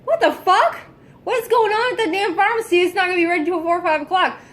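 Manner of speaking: high, exasperated mock yell; retold outraged outburst. Telling viewers how she blew up at pharmacy staff, high outraged rant. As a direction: replay the blow-up at the pharmacy.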